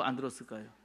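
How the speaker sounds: usual speaking voice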